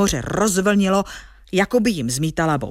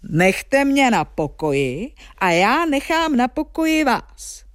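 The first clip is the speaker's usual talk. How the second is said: higher-pitched giant's call; raised giant voice striking a bargain; 5 semitones higher than usual. Acted. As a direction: declare it as the storybook giant. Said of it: "řekl obr".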